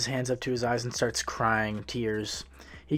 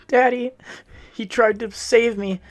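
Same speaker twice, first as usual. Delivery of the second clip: put-on high, loud sobbing little boy; crying child voice; 12 semitones higher, 9 dB louder than usual. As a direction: wail it out through tears like a small child. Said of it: "He blubbers and tries to explain through his crying".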